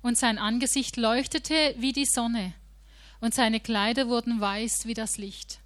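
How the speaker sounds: ordinary talk, nothing marked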